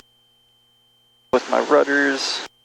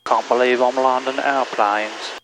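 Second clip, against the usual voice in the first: normal talking voice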